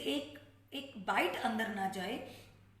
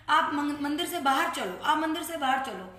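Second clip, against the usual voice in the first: louder, firm order to leave; stern scolding; 5 dB louder than usual. Retold or imitated. Sevadar ordering the aunty out of the temple, loud stern scolding.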